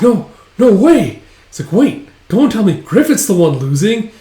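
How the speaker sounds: high, loud shock; acted disbelief. Band watches leader getting beaten, shocked yell. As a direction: cry out as if refusing to believe it.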